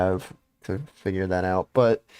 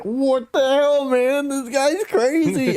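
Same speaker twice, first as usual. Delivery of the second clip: much higher, slow disbelieving cry; incredulous; slower than usual. Retold or imitated. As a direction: hit it like you can't believe him. Retold disbelief at friend.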